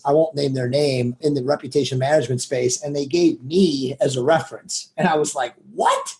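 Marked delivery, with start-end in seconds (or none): 5.7-6.1 very high-pitched, incredulous yelp; reenacted squeal of amazement; astonished reaction. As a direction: shoot the pitch way up in disbelief. Retold amazed reaction to referral.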